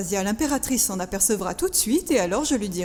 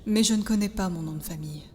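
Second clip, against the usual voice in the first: subdued confession; 4 semitones lower, 5 dB quieter than usual. Acted. Confides being a lost orphan.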